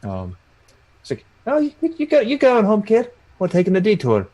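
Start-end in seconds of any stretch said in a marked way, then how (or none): 1.5-4.3 high character voice, easing down as it goes; talking to the kid. Acted. Escort telling the kid of a detour, high voice. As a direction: pitch it up high, addressing a child in character.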